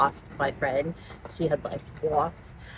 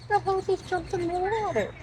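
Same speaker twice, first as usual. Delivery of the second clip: startled; 14 semitones higher than usual. Real, not acted. Startled by something in water.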